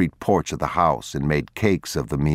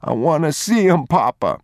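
put-on high child's voice, tearful appeal; boy pleading; 11 semitones higher, 3 dB louder than usual. Boy explaining himself to his father, high and pleading.

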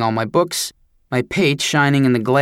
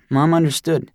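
plain reading voice

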